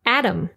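Adam as AD-um, stressed first; English pronunciation. Shown as a demonstration. Teacher contrasting names between languages, English Adam. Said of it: "followed by the English pronunciation".

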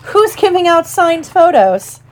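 high, showy demand; self-important teacher calling to the crowd; pompous interruption. A narrator's reading. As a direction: ask grandly, pitched well up. Lockhart breaks in on the crowd.

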